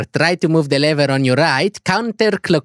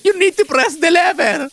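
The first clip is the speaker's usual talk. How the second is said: high-pitched, drawn-out outburst; cracking customer-service yell, much higher; exasperated shout; much slower than usual. Acted. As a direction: lose your temper on a slow instruction. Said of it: "Why you shout?".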